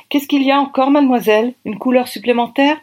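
high-pitched, put-on stylist voice; styliste agacée. A narrator's reading. Styliste lassée interroge la narratrice. (voix de styliste, haut perchée)

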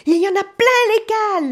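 very high, loud sailor's shout; second sailor whooping it out; excited call. A narrator's reading. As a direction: yell the good news. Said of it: "lance l'autre".

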